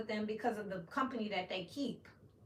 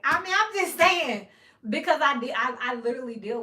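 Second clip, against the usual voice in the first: 1 heated loud aside; 3 semitones higher, 11 dB louder than usual. Real, not acted. Heated aside about felonies.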